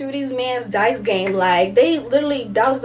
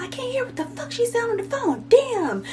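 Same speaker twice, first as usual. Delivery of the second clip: mock yell; 12 semitones higher, 3 dB quieter than usual. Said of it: "she was yelling at them".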